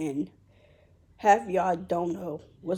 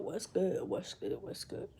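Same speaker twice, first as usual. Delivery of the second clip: each greeting softer until it's gone; playful greeting trailing off into a breath; fading greeting; 8 dB quieter than usual. Pretend. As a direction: let the hello fade out to a whisper. Intro greeting fading to nothing.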